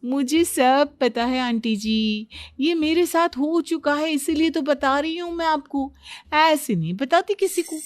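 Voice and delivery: lightly acted boasting to the aunties; know-it-all daughter-in-law. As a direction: share it like a privileged expert.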